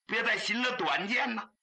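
usual speaking voice

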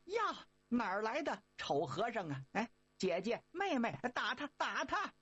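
lightly voiced girls crying hit him; girl voices.

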